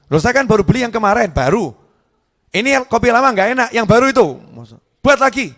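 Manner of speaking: hammed-up husband complaining about old coffee; mock angry husband. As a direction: scold her over the wrong coffee. Husband scolding wife over coffee.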